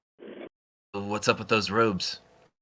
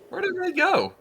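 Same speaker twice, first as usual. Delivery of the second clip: sudden high-pitched confusion; confused high squeak. Acted. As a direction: squeak it out, baffled. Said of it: "I'm like".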